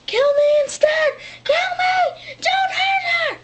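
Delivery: high, loud pleading scream; desperate high-pitched shrieking plea; imitating a scream. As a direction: scream it out as if begging for someone else. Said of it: "on the ground screaming".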